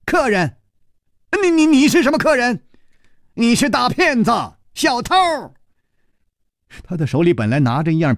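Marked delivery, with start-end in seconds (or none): 0.0-5.1 high, stammering cry; cornered man yells at the intruder; yelling. Acted. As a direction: shriek the accusation in a panic. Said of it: "大叫着说".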